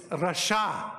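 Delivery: rasha said slowly, both vowels open ah; Hebrew pronunciation rasha. Shown as a demonstration. Teacher modelling the Hebrew word rasha for students. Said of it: "That's how you say this one".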